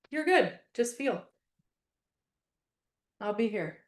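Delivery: understated comforting murmur; gentle support. Retold or imitated. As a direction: ease someone into crying. Voicing a supportive listener.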